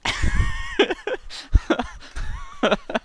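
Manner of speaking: high, long real laugh; laughter. Real, not acted. Reacting to co-host's coronavirus joke, long high laugh. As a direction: crack up in a helpless run of laughs.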